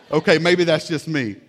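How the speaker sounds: laughing at himself, loud; self-mocking laugh; laughing aside. Real, not acted. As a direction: chuckle through the admission.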